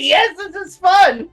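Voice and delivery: very high, slow, sobbing hysterical voice; mock crying. Cohost imitating Aldous crying while bleeding, hammed-up high sob. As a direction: wail it through mock tears. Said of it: "he's almost like".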